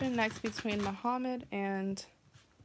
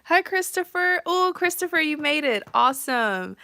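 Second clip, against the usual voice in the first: much louder, higher cheerful welcome; excited greeting; 6 semitones higher, 12 dB louder than usual. Real, not acted. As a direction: call out a delighted hello. Welcomes a viewer arriving live.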